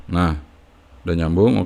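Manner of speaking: ordinary talk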